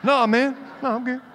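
high-pitched squeak of denial; denying tears. Acted. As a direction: pretend you are not crying, voice jumping up high. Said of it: "Dude, are you crying?".

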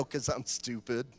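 put-on a kid caught out, owning up under his breath; sheepish kid. Child's sheepish answer to dad.

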